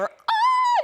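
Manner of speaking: high, hammed-up shocked gasp; mock gasp. Visitor reacting to Ted Bundy's hair, hammed-up gasp. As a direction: gasp like it's real evil.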